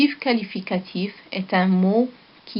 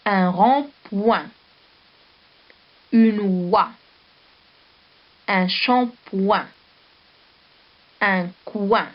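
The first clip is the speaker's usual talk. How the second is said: slow dictation; slower than usual. Shown as a demonstration. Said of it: "Je répète".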